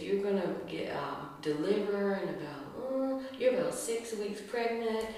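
lightly voiced doctor; a hint of a clinical doctor; doctor's calm report. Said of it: "he says".

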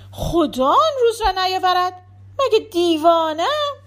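high, loud indignant protest; indignant refusal. Acted. Anis indignantly rejects reconciliation. (اعتراضِ تند با صدای بلند و زیر)

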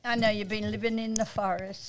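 soft, distant off-mic remark; away from the mic. Real, not acted. Co-host teases host from too far away, off-mic. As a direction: say it softly from back off the mic. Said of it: "Oh, I gotta come closer".

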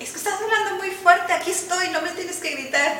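put-on high, sharp scolding of a child; scolding parent. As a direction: scold a noisy kid from up high. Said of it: "Y de que".

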